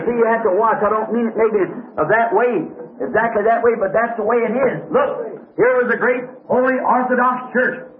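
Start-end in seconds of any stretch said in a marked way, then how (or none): none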